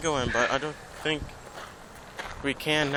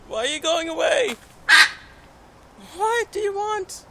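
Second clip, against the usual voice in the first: voicing the parrot; 14 semitones higher than usual. Visitor voicing the parrot asking why they leave, high squeaky bird voice. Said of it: "It's like".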